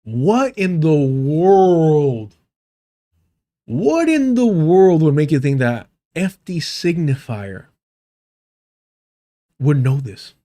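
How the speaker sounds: slow, long-stretched bafflement; baffled disbelief. Real, not acted. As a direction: draw the words out in sheer disbelief. Exaggerated bafflement after the clip.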